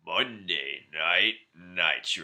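low, slow announcer boom. Acted. Hosting a wrestling podcast, slow deep announcer voice. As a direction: drag out the show name like a wrestling announcer. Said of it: "That was terrible".

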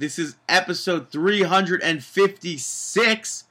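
loud, high announcer welcome; announcer intro. Acted. Host opening the podcast for listeners, big announcer voice. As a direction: boom it out like a show host opening.

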